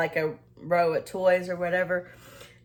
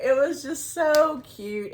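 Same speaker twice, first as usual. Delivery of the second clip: gushing; 6 semitones higher, slower than usual. Real, not acted. Still gushing about the toddler.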